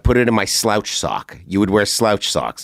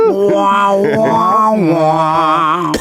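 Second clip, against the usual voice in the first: mock coughing blood; 11 semitones higher, 5 dB louder, much slower than usual. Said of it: "Coughing blood like Val Kilmer".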